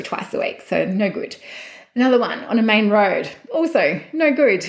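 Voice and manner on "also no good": laughing. Real, not acted. Laughs rejecting another property.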